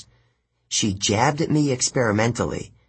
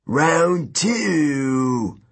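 yelling giant; 6 semitones higher than usual. A narrator's reading. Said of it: "Antaeus yelled".

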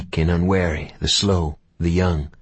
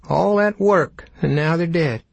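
much higher, reedy ranger voice; high-pitched cowboy voice; 10 semitones higher than usual. Acted. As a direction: squeak it out like a scrawny cowpoke. Rip Green voiced in story.

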